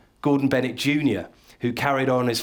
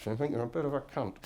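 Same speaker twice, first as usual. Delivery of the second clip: quick, much softer sly aside; sly murmur; 12 dB quieter, faster than usual. Retold or imitated. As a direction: slip the insult out quietly under your breath. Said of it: "calling someone that on the sly".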